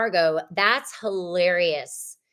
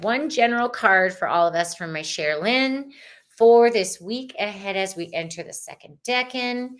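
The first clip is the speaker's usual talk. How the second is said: ordinary talk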